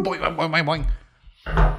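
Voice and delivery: springy boing sound effect; bouncy springy boingy voice. Pretend. Sound effect while moving.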